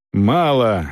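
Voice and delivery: ordinary delivery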